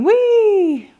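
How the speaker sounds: high, loud whee of delight; playful cartoon whee; gleeful squeal. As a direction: squeal like a puppy having fun. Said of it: "And Spot's going".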